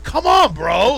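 high, loud mock protest; imitated exasperated yell. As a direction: imitate a pestered coworker crying out. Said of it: "he gets that voice".

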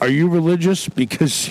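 usual speaking voice

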